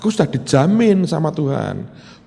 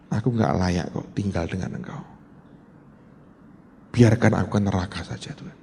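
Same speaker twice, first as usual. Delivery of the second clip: penitent prayer; 7 semitones lower, 7 dB quieter than usual. Retold or imitated. Repentant prayer in dream.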